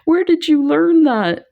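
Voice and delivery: astonished where-did-you-learn-that; surprised question. Retold or imitated. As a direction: ask like you can't believe it. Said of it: "I'm like".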